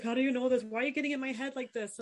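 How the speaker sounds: the stranger caught off guard; startled disbelief. Retold or imitated. Man at the bar asking how she knew, in startled disbelief.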